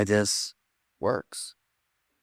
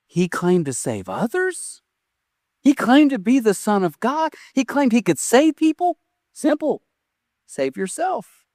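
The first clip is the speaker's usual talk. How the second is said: higher-pitched, incredulous challenge; taunting naysayers; 8 semitones higher than usual. Retold or imitated. Doubters challenge Jesus on the cross, acted taunting. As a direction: act out doubters jeering, questions pitched up.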